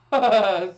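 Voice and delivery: sudden loud laugh; burst of laughter. Real, not acted. Host laughing at a slip. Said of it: "I know I'm laughing".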